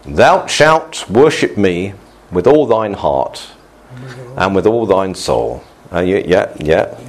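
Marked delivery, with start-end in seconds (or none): 0.0-5.6 slow, grand King James proclamation; pompous prophet voice. Acted. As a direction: intone it like an old-style prophet booming from the pulpit. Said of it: "and they said".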